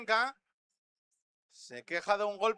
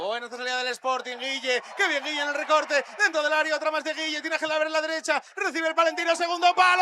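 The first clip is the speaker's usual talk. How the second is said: rising live commentary; 5 semitones higher, 6 dB louder than usual. Real, not acted. Live goal chance play-by-play.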